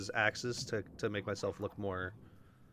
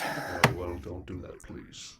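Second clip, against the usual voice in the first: orc voice; 4 semitones lower, slower than usual. Acted. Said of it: "sound like I wanna shoot you".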